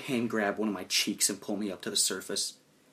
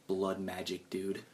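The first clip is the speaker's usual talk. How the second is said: low, soft secret aside; mouthed aside; 5 semitones lower, 9 dB quieter than usual. Acted. Companion winks and mouths to friend, hushed aside. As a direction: slip it to a friend under the voice. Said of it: "and mouth the words".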